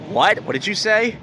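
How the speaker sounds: imitated high-pitched disbelief; incredulous reply. Retold woman's incredulous reply to compliment.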